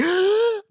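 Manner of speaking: sharp high gasp of delight; imitating an excited gasp. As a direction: gasp like you can't believe it. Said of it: "I audibly gasped in excitement".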